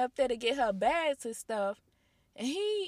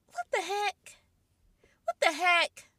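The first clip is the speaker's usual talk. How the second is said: exasperated; 7 semitones higher, 4 dB louder than usual. Retold or imitated. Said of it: "I just be like".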